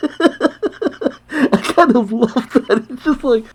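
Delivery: high-pitched, slow, through laughter; laughing. Real, not acted. Reader reacting to the robot punchline, laughing. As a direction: say it while cracking up.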